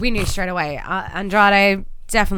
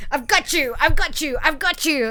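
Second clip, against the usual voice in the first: higher, triumphant repeated gotcha; gleeful gotcha; 8 semitones higher than usual. Retold or imitated. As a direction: gloat over the catch. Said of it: "he's like".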